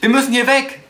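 shouted high, pushing to flee; urgent shout. Acted. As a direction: yell at her to run. Flight from a shootout. (Karen anschreien, dass sie fliehen muss, laut)

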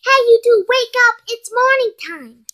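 bossy morning holler; wake-up call. Acted. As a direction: call sleepers out of bed. Waking the boys up.

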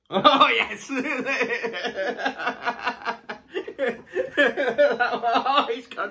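high laughing cries. Real, not acted. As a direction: laugh hard at his sour face. Laughing at partner's sour reaction.

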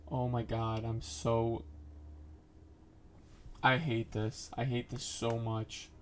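ordinary delivery

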